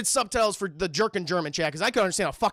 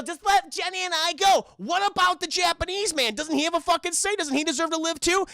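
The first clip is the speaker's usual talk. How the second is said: higher, louder pleading; mock pleading girl; 9 semitones higher, 3 dB louder than usual. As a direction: beg the captor.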